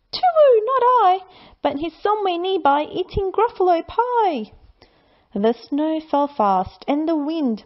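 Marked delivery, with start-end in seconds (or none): 0.1-4.5 high hooting owl, much higher. Acted. Owl answering the Gruffalo's child, high hooting voice. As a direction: hoot it like a wise owl.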